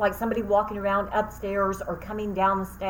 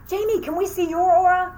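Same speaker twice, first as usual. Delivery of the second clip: eager students; 9 semitones higher, 5 dB louder than usual. Retold or imitated. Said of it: "they said".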